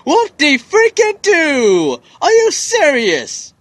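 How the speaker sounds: drawn-out sarcastic complaint, voice swooping down; exasperated sarcasm. Real, not acted. Player whose game crashed on him, exasperated. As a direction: complain loudly in mock celebration.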